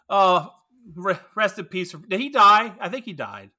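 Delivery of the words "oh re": ordinary delivery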